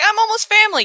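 high pleading whine; mock plea. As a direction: insist you belong. Flynn pleading.

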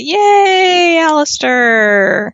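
high, loud, drawn-out cheer; mock cheer. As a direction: cheer it out, stretched slowly. Mocking fan cheer for Aleister.

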